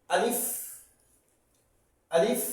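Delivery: teacher sounding out আলিফ for students to copy; clear Arabic letter names. Shown as a demonstration. Teacher modelling letter sounds for learners, clear drill. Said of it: "উচ্চারণ হবে".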